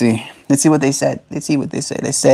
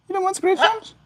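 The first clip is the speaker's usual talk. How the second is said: tiny, very high falsetto question; squeaky pet talk; 6 dB quieter than usual. Real, not acted. Owner offering bird scritches, squeaky falsetto baby talk.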